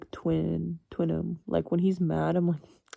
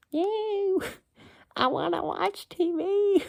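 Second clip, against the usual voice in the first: put-on much higher, slow, like a gleeful little kid; childish inner voice; 13 semitones higher, much slower than usual. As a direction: squeal it like a child who wants TV.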